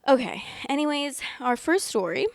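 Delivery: ordinary talk, nothing marked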